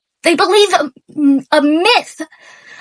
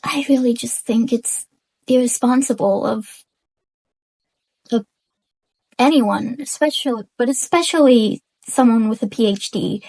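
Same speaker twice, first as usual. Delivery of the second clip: low, soft, measured restatement; calmed down; 5 semitones lower, 5 dB quieter than usual. Real, not acted. Explaining why it's irresponsible, calm and subdued. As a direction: make the point quietly, pitch kept low. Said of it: "I'm calm now".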